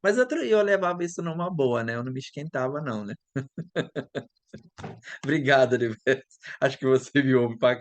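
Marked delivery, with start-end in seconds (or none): 3.2-4.2 short quiet real laugh; a soft laugh after the story. Real, not acted. Laughing off the professor's remark, a soft real laugh.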